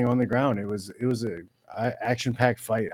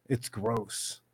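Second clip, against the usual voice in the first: much softer, lower, dropped disgust; muttered disgust; 4 semitones lower, 12 dB quieter, much slower than usual. Shown as a demonstration. Repeating his disgust at the announcers, soft and low. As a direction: mutter it with a sneer. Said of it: "Capital G".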